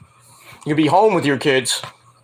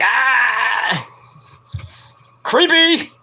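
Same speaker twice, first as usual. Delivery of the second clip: mock yelp; 6 semitones higher, much slower than usual. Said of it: "Nia's like".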